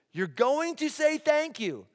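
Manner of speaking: high, slow, emphatic parental order; insistent parent. Retold or imitated. Father telling his kids to use manners, insistent. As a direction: lay down the law, drawing it out.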